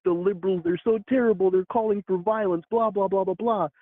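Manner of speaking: high-pitched mock pearl-clutching; Ben Shapiro. Pundit complaining about the liberals, mocking high-pitched outrage. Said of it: "like the Ben Shapiro's of the world are like".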